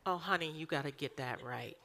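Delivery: mock sweet coaxing voice; gentle coaxing. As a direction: coo it like a doting aunt. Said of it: "He's not like".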